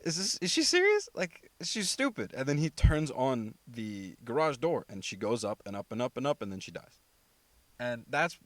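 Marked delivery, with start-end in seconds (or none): 0.0-2.3 much higher, loud, mocking disbelief, slowing at the end; a killer who can't believe how dumb she is; disbelieving. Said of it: "He was like".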